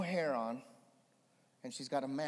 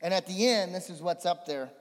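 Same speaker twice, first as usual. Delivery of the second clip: ordinary talk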